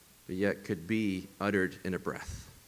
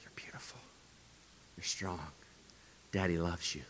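tender bedtime whisper; a father's gentle goodnight whisper; whispered affirmations; 3 semitones lower, 13 dB quieter than usual. Retold or imitated. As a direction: whisper softly into a child's ear. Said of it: "I just finished".

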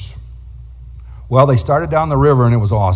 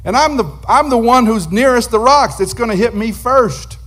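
raised, worried retelling; mild alarm; 8 semitones higher than usual. Retold or imitated. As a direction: voice Lenny's panic on the raft, a bit higher. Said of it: "And Lenny said".

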